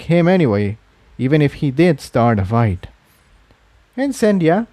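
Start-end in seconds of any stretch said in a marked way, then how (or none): 4.0-4.7 curt. A narrator's reading. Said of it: "she said curtly".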